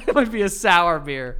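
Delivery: breaking into laughter at his own joke; laughing. Real, not acted. Repeating his sour beer joke to his co-host, laughing.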